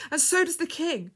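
high, loud conviction; passionate. A narrator's reading. Woman assuring the girl of the King's care, passionately. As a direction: insist on it fervently.

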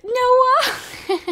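high call with a laugh through it. Real, not acted. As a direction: call him again, cracking up. Calling again, laughing.

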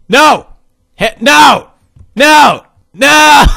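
loud, high bursts of dismay; frantic shouts of no. Real, not acted. Gamer failing in the cannon level, frantic shouting. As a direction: yell each one as it fails. Game goes wrong.

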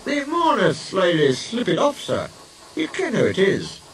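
high-pitched Scottish engine voice; put-on Scottish character, high voice; Scottish twins. A narrator's reading. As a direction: answer in a high Scottish accent. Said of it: "The engines spoke in chorus".

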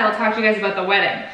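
usual speaking voice

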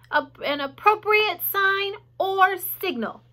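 high, slow formal announcement; pompous little public announcement; loud, firm voice. A narrator's reading. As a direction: address the whole barn, slowly and firmly. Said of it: "he said in a loud, firm voice".

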